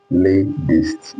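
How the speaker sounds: LATEST as lay-dist, with a D; American flap T. Shown as a demonstration. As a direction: soften the middle T of LATEST to D. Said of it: "You hear the sound of D".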